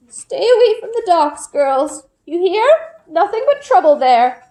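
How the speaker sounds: put-on an old woman warning the girls off. Olga warns the girls.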